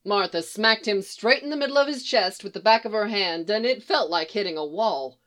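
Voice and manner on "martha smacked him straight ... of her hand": ordinary delivery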